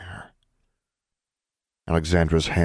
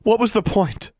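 high, loud pained demand; angry question; 4 dB louder than usual. A narrator's reading. Alexandra pressing Darla, high loud demand. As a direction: demand an answer. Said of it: "she asked".

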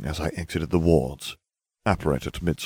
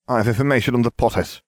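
eager lackey; 6 semitones higher, 4 dB louder, faster than usual. A narrator's reading. Pettigrew eagerly blurting news.

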